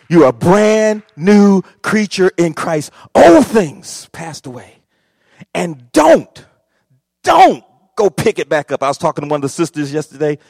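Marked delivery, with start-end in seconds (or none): 0.1-8.8 loud exhortation. Real, not acted. Preacher exhorting.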